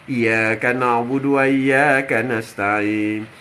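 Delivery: low, drawn-out chant; slow Quranic recitation. Acted. Preacher reciting in prayer before God, slow chant. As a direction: intone the verse slowly, facing God.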